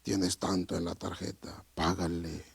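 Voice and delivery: very soft, low voice of God. Retold or imitated. Preacher shows God's gentle prompting to pay, soft voice. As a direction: murmur it deep and gentle, as a divine prompting. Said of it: "Pero voz suave".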